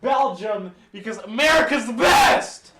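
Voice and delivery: shouting. Real, not acted. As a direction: yell it out proudly. Drunk patriotic outburst.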